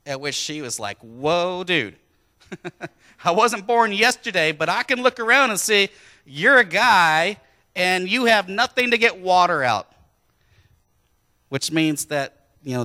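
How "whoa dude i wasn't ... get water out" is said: louder, sassy, laughing skeptic; a hint of a sassy modern woman; cheeky woman. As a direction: tease him like a street-smart skeptic. Preacher voices cheeky Samaritan woman.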